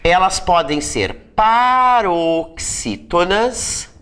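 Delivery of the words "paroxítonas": PAROXÍTONAS stretched very slowly, syllable by syllable; drawn-out term. Shown as a demonstration. Teacher naming the second class to students, drawn-out term. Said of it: "as palavras podem ter três classificações".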